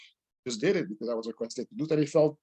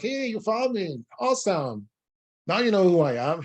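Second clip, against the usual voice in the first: retelling her delight in a raised voice; excited; 6 semitones higher than usual. Retold or imitated. The queen in the dream greeting the painter, excitedly. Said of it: "she's all excited".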